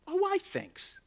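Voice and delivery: high, quiet, dry sarcastic thanks; reply with polite sarcasm; mock Jesus reply. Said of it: "Jesus replying".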